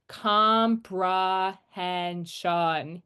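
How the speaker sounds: Comprehension said very slowly with schwas; slow schwa demo. Shown as a demonstration. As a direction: stretch Comprehension to show each schwa. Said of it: "there's multiple schwa sounds".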